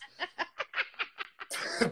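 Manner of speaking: high, drawn-out laughing fit; helpless laughter. Real, not acted. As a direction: crack up laughing at your own slip. Cracking up at own word slip.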